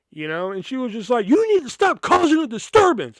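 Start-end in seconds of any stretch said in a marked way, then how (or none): 1.3-3.2 stern teacher. Retold or imitated. Quoting the strict teacher scolding.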